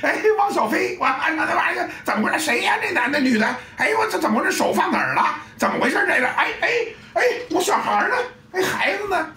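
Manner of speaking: high, fast, confused bystander; flustered gawker. Acted. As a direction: play a gawker who lost his kid. Acting a distracted gawker.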